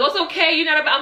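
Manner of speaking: high, light voice for the guy consoling her; imitating the guy's comfort. Retelling how the guy comforted her, in a high mock voice. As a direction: mimic him telling her it's okay. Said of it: "He was like".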